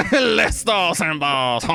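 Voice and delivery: put-on slow, hammy French accent; mock French accent. Echoing co-host's rebel flag joke, mock French accent. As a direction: stretch it out in fake French. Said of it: "Our accents are way all over the place".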